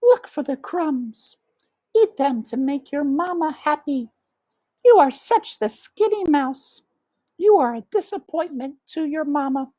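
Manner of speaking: high foreign-tinged mama mouse; mother. A narrator's reading. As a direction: nag like a doting mother. Mother nags.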